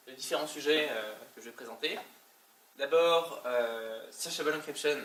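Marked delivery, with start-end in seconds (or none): 4.1-5.0 English said with a heavy French accent; bad English accent. Real, not acted. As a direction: read the English term the French way. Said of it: "j'ai un accent anglais qui est épouvantable".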